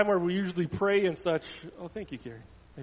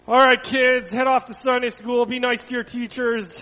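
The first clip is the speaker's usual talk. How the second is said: loud, raised dismissal to a group; calling out; 6 semitones higher, 11 dB louder than usual. Real, not acted. Pastor sending kids off to Sunday school, calling out.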